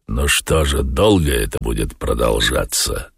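low character voice, sinking further; Воланд. A narrator's reading. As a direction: ask low, letting it drop. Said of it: "спросил Воланд". (низким голосом, с понижением)

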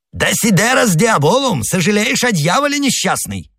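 high-pitched, scandalised exclamation; aghast priest crying out; Jesuit's outcry. A narrator's reading. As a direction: cry out in horrified reproach, far above normal pitch. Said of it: "вскричал иезуит".

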